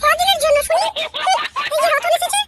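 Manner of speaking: high-pitched scolding wife, mocking disbelief; a nagging wife who doesn't buy his promise; sarcastic wife. Wife mocks husband's promise to quit drink, high and scornful.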